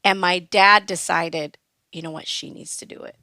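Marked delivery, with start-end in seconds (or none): 1.9-3.2 dad's words in a whisper; softly voiced father deciding; hushed dad. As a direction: murmur it as a quiet decision. Dad's quiet decision.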